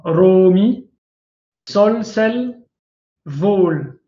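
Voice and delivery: ROmi, SOLsel, VOL, each with its first syllable leaned on; first-syllable stress. Shown as a demonstration. Teacher showing Coptic word accent, "Romi, sol, sel, vol" stressed on the first syllable. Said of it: "on accentue la première syllabe".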